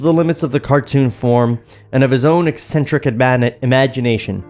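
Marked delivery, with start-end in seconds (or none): none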